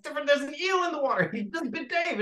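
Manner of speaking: high, loud, rushed protest; frantic mock shrieking; retold panic. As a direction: re-enact a terrified objection to the director. Retelling panicked protest to director.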